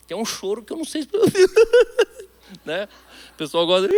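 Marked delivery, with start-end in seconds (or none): 1.1-2.2 short high laugh, real; burst of laughter. Real, not acted. Pastor laughing at himself crying at the movies, a high laugh. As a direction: laugh out at yourself.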